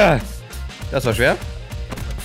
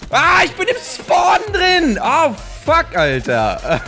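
frustrated shout; 12 semitones higher, slower than usual. Real, not acted. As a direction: shout out in dismay. Startled in the game.

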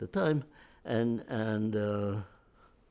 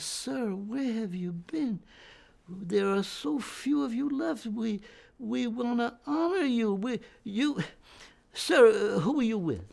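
imitating the Dutch officer; 10 semitones higher, slower than usual. Dutch officer greets the veteran.

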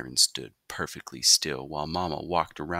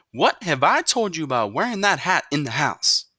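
higher, louder mother's scolding; 10 semitones higher, 6 dB louder than usual. A narrator's reading. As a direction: tell him off like a mother.

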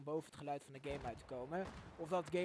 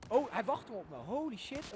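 startled shout; 7 semitones higher, 9 dB louder than usual. Real, not acted. Ambushed by enemy mid-game.

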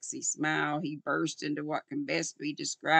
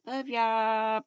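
usual speaking voice

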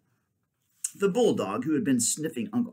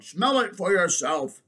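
higher, drawn-out bulldog voice; insistent bulldog; 6 semitones higher, 4 dB louder than usual. Acted. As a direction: challenge them slowly as the bulldog.

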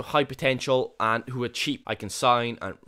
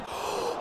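quick unvoiced gasp of disbelief; sharp gasp; 7 dB quieter than usual. Real, not acted. Manager seeing a sitter missed, gasp. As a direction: suck in air at the miss.

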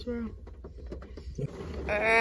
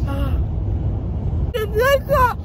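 excited; 3 semitones higher, 9 dB louder than usual. Real, not acted. Drugged patient shouting about TikTok.

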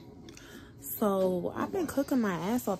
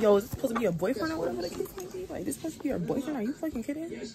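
higher-pitched, quick mock outrage; retold indignation; 5 semitones higher, 3 dB quieter, much faster than usual. Owner confronting cat about supposed boyfriend, retold indignation. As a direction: grill her in mock disbelief, without raising volume. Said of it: "I get mad as fuck".

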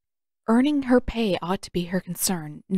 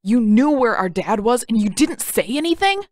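higher, louder accusing shout; angry outburst; 4 semitones higher, 6 dB louder than usual. Acted. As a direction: confront him, betrayed and furious. Sokka grabs Aang, furious.

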